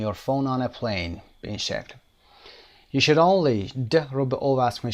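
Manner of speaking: usual speaking voice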